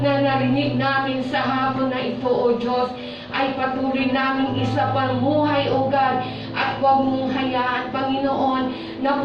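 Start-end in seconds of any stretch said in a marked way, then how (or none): none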